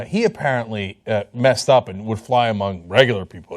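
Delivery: usual speaking voice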